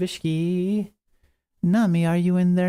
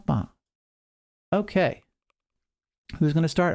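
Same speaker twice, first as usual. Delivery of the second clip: usual speaking voice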